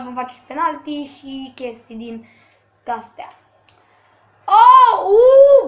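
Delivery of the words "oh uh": high, loud laughing exclamation. Real, not acted. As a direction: burst out laughing at the play. Reacting to play, laughing.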